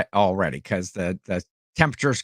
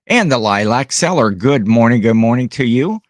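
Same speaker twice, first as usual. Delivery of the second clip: loud greeting; 8 dB louder than usual. Real, not acted. Host welcoming a viewer who popped in, loud greeting. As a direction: call out a loud hello.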